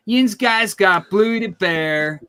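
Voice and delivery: Pittsburgh yinzer voice hyping up the Bluey bear token; Pittsburgh accent. Acted. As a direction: lay the Pittsburgh yinzer talk on thick and slow. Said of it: "we can bring the, uh, Pittsburgh accent on here".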